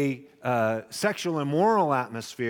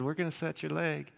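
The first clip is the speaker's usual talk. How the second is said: soft calm doctor; 7 dB quieter than usual. Retold or imitated. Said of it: "they said".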